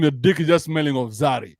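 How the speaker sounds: normal talking voice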